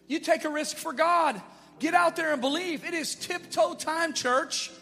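loud, high impassioned preaching; rally the church to step out; preaching at full force. Real, not acted. Pastor urging the church to give, loud preaching.

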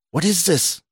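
ordinary delivery, nothing marked

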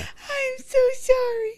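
high, slow, sobbing little boy imitation; mock-wail an apology like a toddler; imitating crying kid. Mom imitating her son apologising after a swat, high slow mock sobbing.

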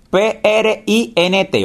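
slow, letter-by-letter dictation; spelling letter by letter. Shown as a demonstration. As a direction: spell each letter out slowly. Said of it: "vamos entonces a escribir print". (deletreando despacio, letra por letra)

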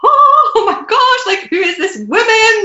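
high, slow breathless wonder at the vision; awestruck gasp. Retold or imitated. Retelling the meditation vision to the host, high astonished wonder. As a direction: gasp it out as if seeing someone unreal. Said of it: "I just remember thinking".

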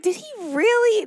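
high-pitched retold amazement; delighted disbelief. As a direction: act out a touched, surprised "really?". Acts out her delighted reaction.

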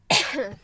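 sharp real cough; hacking cough mid-sentence; coughing fit. Real, not acted. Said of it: "ho un attacco di tosse infinito".

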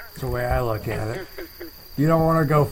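ordinary talk, nothing marked

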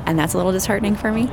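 ordinary talk, nothing marked

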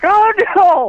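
jumps way up in a shrill mock panic; a hammed-up squeal of alarm; high, loud mock dismay. Dad reacts to son's boyfriend.

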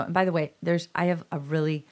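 ordinary talk

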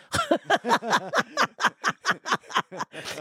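high, open burst of laughter; hearty laugh. Real, not acted. Laughing at his own story.

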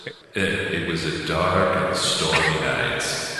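slow, spooky storyteller voice; drawn-out haunted house narration; spooky narrator. Acted. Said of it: "That sounded like Haunted Mansion".

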